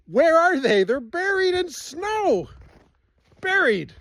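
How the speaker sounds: high, loud exclaim at the buried chairs; amazed disbelief. Real, not acted. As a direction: pitch it up in real surprise, stretching buried. Chairs buried in deep snow.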